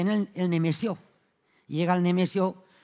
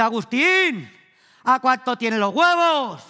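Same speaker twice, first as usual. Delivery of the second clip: louder, higher call across the shop; 5 semitones higher, 7 dB louder, slower than usual. Retold or imitated. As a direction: shout the question to the shopkeeper. Woman calls shopkeeper. (llamando al tendero en voz alta y aguda)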